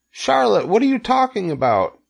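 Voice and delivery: very high, distressed pig voice; alarmed cry. A narrator's reading. Wilbur questions his dying friend, alarmed cry. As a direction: plead in high-pitched dismay. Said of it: "he cried".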